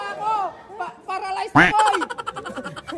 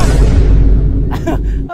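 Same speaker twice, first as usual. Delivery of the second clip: breathy laugh; 8 dB louder than usual. Real, not acted. Laughing after ride.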